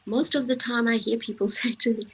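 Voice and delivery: ordinary talk, nothing marked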